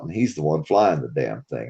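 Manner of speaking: ordinary talk, nothing marked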